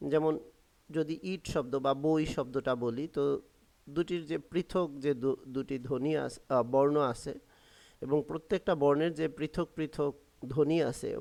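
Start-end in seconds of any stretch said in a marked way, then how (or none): none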